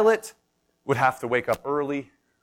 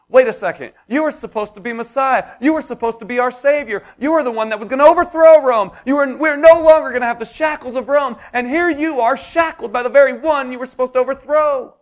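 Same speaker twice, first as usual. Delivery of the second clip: louder, higher, crying out in betrayal; betrayed outrage; 10 semitones higher, 8 dB louder than usual. Acted. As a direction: voice the crowd's shock that their savior is in chains. Preacher voicing betrayed crowd.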